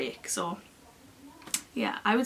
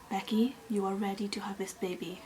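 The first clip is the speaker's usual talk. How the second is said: soft, measured announcement; calm midwife; 5 dB quieter than usual. Retold or imitated. Midwife telling patient the baby is ready, calm voice. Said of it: "She was still so calm".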